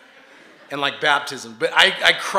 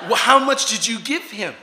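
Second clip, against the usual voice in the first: high, loud incredulous question; mock alarmed demand; 8 semitones higher, 11 dB louder than usual. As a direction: challenge them in comic alarm. Retold alarmed challenge to anesthesiologist.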